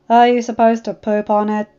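loud, cheeky demand; teen rider loudly heckling the dragon. Acted. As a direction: blurt it out loud like a brat. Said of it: "Snotlout demanded".

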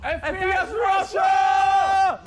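F P S Russia stretched slow, high and loud; slow gleeful yell; drawn-out shout. Real, not acted. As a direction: bellow the name like a hype man. Echoing the name even louder.

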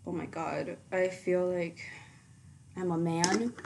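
usual speaking voice

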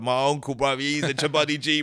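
imitated Nigerian accent. Nigerian fan telling the comic about his uncle, imitated Nigerian accent. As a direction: put on a Nigerian fan's accent. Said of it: "I got Nigerian brothers came up to me".

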